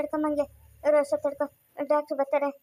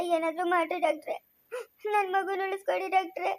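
softer, tearful begging; crying plea; 4 dB quieter than usual. Acted. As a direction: plead through tears. Pleading doctor to save baby.

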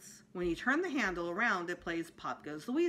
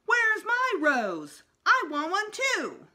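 high, loud, slow demanding yell; whiny holler; 13 semitones higher, 9 dB louder, slower than usual. A narrator's reading. Little girl hollering for her rose, high and loud. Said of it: "Jenny hollered".

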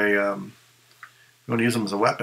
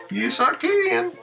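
much higher, slow ventriloquist dummy voice; high puppet reply to his owner; Lil Feller puppet voice; slower than usual. Acted. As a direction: answer as the little puppet, pitched way up. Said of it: "right, little feller?".

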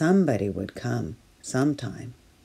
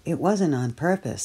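usual speaking voice

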